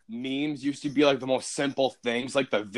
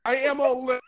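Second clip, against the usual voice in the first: ordinary talk, nothing marked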